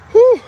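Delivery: big exhale of relief after running; loud relieved whew. Real, not acted. Relief after finishing the run.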